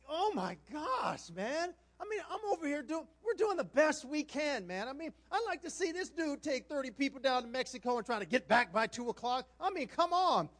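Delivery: high, loud exasperated rant; exasperated venting. Retold or imitated. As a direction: vent as if nobody can hear. Venting, thinking the call ended.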